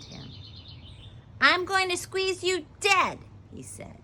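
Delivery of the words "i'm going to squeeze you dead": high, loud grumpy snake; grumpy snake threat. A narrator's reading. Snake threatens the girl who woke him, grumpy and loud. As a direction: threaten loudly, pitched well up. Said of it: "Sleepy and grumping and hissing".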